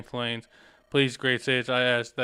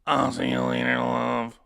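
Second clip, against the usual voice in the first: slow, eerie ghoulish female voice; drawn-out spooky woman's voice; slower than usual. Acted. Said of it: "that ghoulish voice for, uh, Elora".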